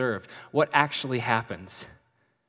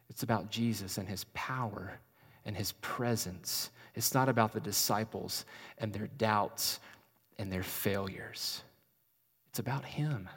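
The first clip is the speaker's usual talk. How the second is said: ordinary delivery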